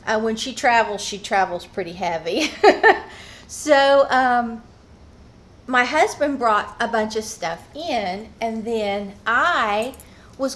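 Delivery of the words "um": normal talking voice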